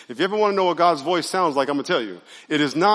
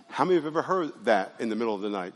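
ordinary delivery

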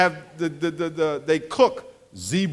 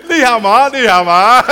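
mock Chinese greeting; 3 semitones higher, 13 dB louder than usual. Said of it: "And I ain't saying".

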